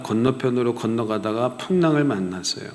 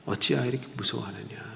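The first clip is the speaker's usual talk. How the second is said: 어찌하여 said much softer; soft repeat; 10 dB quieter, faster than usual. Shown as a demonstration. Jesus repeating the rebuke to the disciples, softly. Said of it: "이렇게 책망하십니다".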